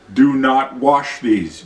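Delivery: ordinary delivery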